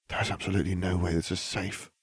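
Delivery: soft under the breath; muttered. A narrator's reading. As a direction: mutter it to yourself. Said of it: "Hermione muttered".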